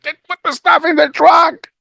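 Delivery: high, loud command; exasperated order. Retold or imitated. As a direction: snap the order at the stuck kid. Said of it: "And I said".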